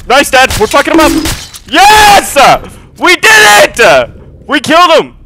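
high, loud triumphant yelling; victory shout. Real, not acted. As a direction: celebrate the kill at the top of your lungs. Celebrating a kill with his dad.

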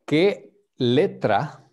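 slow the question down for a beginner; slow clear question. Shown as a demonstration. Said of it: "repeats question slowly for student".

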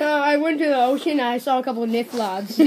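fast, giggling through the words; laughing. Real, not acted. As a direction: laugh while rushing it. Giggling over a made-up word.

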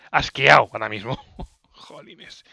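breathy whisper, fed up; whispered exasperation; whispered disgust. Real, not acted. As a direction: whisper it, sick of it all. Disgusted muttering after dying.

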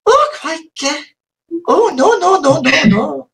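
high-pitched attempt at Scottish; Scottish accent. Shown as a demonstration. Showing the few Scottish words they can manage, rough Scottish accent. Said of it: "I can't speak Scottish apart from".

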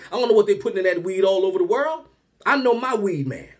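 usual speaking voice

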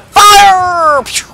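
high loud battle cry; shouted fire. Real, not acted. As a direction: yell the command. Firing rocket.

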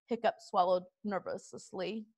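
ordinary delivery, nothing marked

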